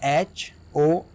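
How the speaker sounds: slow letter-by-letter spelling of एच ओ एन ओ यू आर. Shown as a demonstration. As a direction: spell each letter out slowly for the class. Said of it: "तो". (धीरे-धीरे अक्षर बोलकर spelling)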